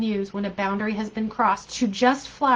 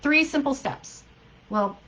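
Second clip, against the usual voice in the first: normal talking voice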